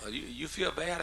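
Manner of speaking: southern lilt, rising question; teasing southern accent. Retold or imitated. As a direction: lightly imitate a wife's southern accent. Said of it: "kidding her about her southern accent".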